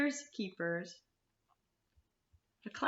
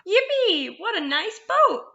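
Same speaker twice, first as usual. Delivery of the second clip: excited ant; 10 semitones higher than usual. A narrator's reading. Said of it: "cried the ant".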